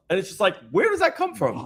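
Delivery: ordinary talk, nothing marked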